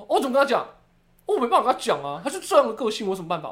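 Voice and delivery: high, loud whiny excuse; mock helpless dad. Daughter imitates dad refusing to complain, high whine. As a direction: whine like a father who won't confront anyone. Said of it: "他说".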